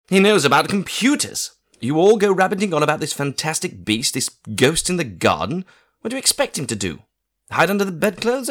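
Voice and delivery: indignant aunt telling everyone off; exasperated aunt. A narrator's reading. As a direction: tick off the grown-ups like a fed-up aunt. Aunt lectures the adults.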